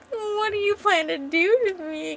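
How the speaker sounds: high, drawn-out tipsy woman; tipsy teasing. Retold or imitated. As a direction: tease him, slow and high. Drunk woman teasing him.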